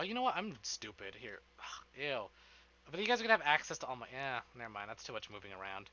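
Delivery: usual speaking voice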